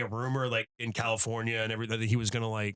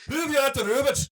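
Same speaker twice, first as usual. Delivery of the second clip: high, loud mock Scottish; Scottish accent; 13 semitones higher, 5 dB louder than usual. Host mimicking a joke written in Scottish, mock Scottish accent. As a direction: take off a broad Scottish accent, pitched way up. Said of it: "in a Scottish accent".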